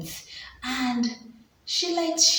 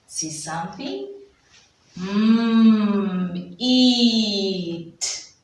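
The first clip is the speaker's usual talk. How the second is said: ordinary talk, nothing marked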